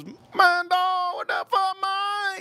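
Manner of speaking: very high squeaky mock voice of friend; imitating friend. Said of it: "He goes".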